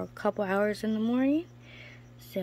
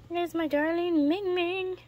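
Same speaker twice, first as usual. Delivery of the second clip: higher sing-song pet voice; 10 semitones higher, 4 dB louder than usual. Real, not acted. Spotting her gecko Ming Ming, gushing baby talk. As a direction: gush over the gecko.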